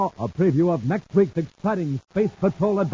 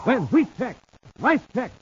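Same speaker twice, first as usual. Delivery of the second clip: radio announcer; 5 semitones higher than usual. Acted. Announcer hyping next Saturday's episode, big announcer voice. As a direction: build up to the show's name.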